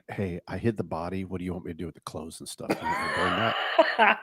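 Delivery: low, quiet, dead serious; deadpan serious. Pretend. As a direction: say it flat like a confession. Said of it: "be serious as heck".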